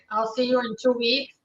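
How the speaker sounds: ordinary talk, nothing marked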